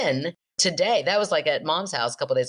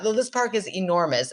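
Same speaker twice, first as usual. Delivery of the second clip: normal talking voice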